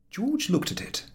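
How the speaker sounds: ordinary delivery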